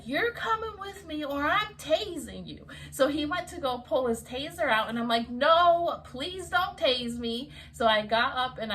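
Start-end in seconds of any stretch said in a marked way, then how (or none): 0.0-2.7 high-pitched mock threat; threatening officer. Cop threatens her with the taser, imitated heatedly. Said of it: "He was like". 5.3-7.5 high, loud "no" into a slow plea; retold pleading. She begs the cop not to tase her, mock pleading.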